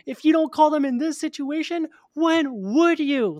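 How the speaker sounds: loud, high exasperated shout; furious yell. Retold or imitated. Bleeding man yelling at a dithering bystander, furious shout. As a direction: yell it at them, bleeding out and enraged. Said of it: "I yell".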